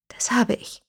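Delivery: small, fragile admission; thin, faltering voice; suddenly thin voice. A narrator's reading. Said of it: "mit plötzlich dünner Stimme".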